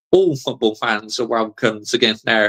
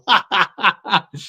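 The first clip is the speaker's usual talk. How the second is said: high laugh; 7 semitones higher than usual. Real, not acted. Laughing again.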